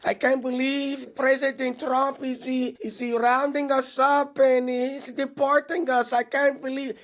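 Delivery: high, slow whiny voice of disbelief; mocking disbelief. Host mimics dismayed voters, high, slow and whiny. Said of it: "watching some of these Latinos".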